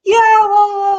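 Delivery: dismissive daughter. Retold or imitated. Daughter shrugging off her dad's question, mocking brush-off. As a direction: wave it away with a bored blah blah. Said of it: "And she goes".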